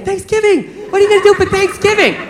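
high-pitched mimicry of an eager girlfriend; excited girlfriend. Girlfriend interrupting his game viewing, mock excitement. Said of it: "so she's all excited".

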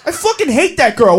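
ordinary delivery

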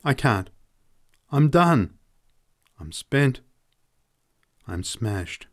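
a man at the end of his rope; broken despair. A narrator's reading. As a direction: voice cracking then collapsing. Man breaking down emotionally.

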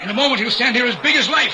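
high-pitched, feverish exultation; excited triumph. Acted. Ritual leader promising the man will appear, feverishly excited. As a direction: proclaim it in wild excitement.

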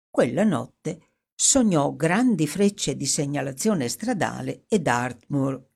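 ordinary delivery

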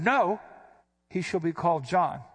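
sharp, startled No, then he shall be called John; abrupt emphatic no. Shown as a demonstration. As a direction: snap out the No like a sudden reaction. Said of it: "why do I keep inflecting like that".

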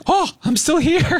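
high, drawn-out amazement; waking up astonished, voice shooting up; mock relieved surprise. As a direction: act amazed to have survived. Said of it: "you start feeling your body like".

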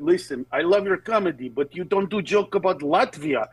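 imitating a heavy-accented fan; mock Eastern European accent complaining about Latvia; Latvian fan voice. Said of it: "and he went".

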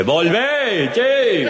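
high yelled call to come back; instructor's shout. Acted. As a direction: call him back from far off. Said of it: "escucha el grito del instructor".

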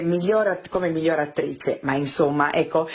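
usual speaking voice

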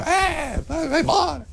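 high-pitched mock heckle; heckling opera audience. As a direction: jeer a singer off stage in a high voice. Acting out old opera audience booing.

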